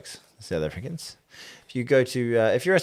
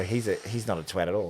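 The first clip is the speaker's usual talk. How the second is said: normal talking voice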